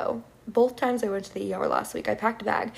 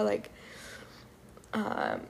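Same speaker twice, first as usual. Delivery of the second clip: ordinary talk, nothing marked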